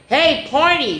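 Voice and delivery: high bird call. A narrator's reading. Blue jay Squawky calling to Pointy, high. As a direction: hail him from a branch. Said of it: "Squawky called".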